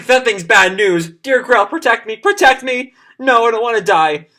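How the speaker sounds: hammed-up cowardly plea; panicked Othello. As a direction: cower and plead behind Grell. Othello hiding behind Grell, panicking.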